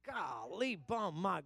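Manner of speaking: normal talking voice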